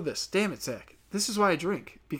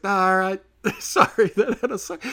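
mock sheepish drill instructor; 7 semitones higher, 6 dB louder than usual. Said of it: "the drill instructor's just like".

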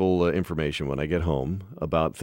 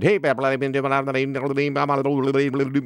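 fast rapid-fire auction patter, higher; auctioneer voice; 6 semitones higher, slower than usual. Retold or imitated. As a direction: rattle off bids like an auctioneer. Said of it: "that was my auctioneer voice".

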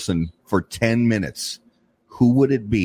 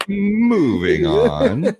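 drawn-out, higher, through laughter; laughing; 9 semitones higher, much slower than usual. Real, not acted. Host changing the subject after a cheating joke, laughing. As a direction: stretch it out while laughing.

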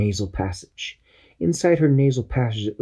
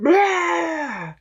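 high comic squeal; germ's squeal. Acted. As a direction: yelp as the medicine hits. Germ hit by medicine.

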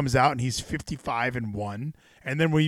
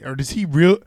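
ordinary talk

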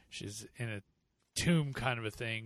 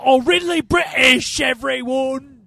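high, loud, slow overdone British voice; hammed-up British show-off; mock posh British; 13 semitones higher, 14 dB louder, slower than usual. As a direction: lampoon a try-hard Brit. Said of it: "some people".

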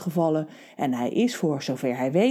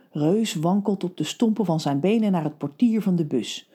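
ordinary delivery, nothing marked

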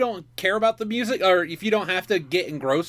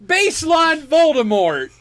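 excited; 7 semitones higher, 4 dB louder, slower than usual. Real, not acted. Crying out in disbelief.

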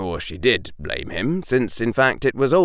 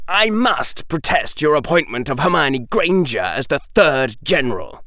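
stiffly formal, higher-pitched protest; most formal voice; 6 semitones higher, 3 dB louder than usual. Acted. Draco protesting Hermione's appointment, most formal. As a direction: lodge an official objection. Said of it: "in his most formal voice".

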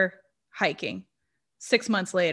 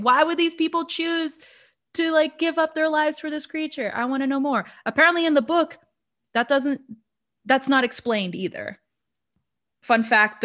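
usual speaking voice

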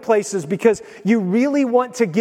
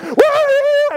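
high, drawn-out "woo" cheer; imitated excited crowd whoop; game-crowd whoop; 4 dB louder than usual. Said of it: "go to an I U game and be like".

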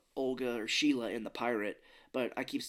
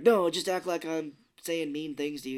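insistent coaching; 4 semitones higher, 7 dB louder than usual. Retold or imitated. Coaching a staged argument.

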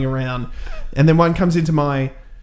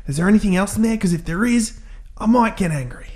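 mock cop; 6 semitones higher, faster than usual. Retold cop warning him.

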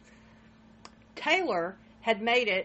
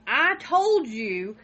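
slow, louder mock shout; retold shout; 4 semitones higher, 8 dB louder, much slower than usual. Wife retelling the 911 call, slow mock shout. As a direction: drag out the words in a mild mock yell. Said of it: "she's shouting".

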